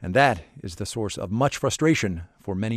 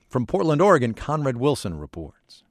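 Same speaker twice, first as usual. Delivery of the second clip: ordinary talk, nothing marked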